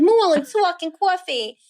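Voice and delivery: hammed-up New Jersey Shakespeare; thick Jersey accent. Student in first Shakespeare class, in thick Jersey accent. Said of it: "it was very thick".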